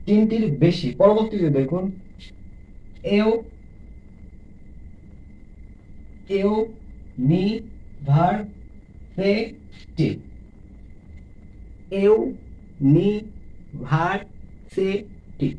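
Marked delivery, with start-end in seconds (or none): 3.2-10.1 false start, then "university" in five beats; ইউ ... নি ... ভার ... সি ... টি, very slowly; syllable by syllable. Shown as a demonstration. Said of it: "পাঁচটি রয়েছে". 12.0-15.5 "university" as ইউ, নি, ভার, সি, টি; five slow isolated beats in university; teacher counting out university by syllable. Shown as a demonstration. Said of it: "পাঁচটি রয়েছে".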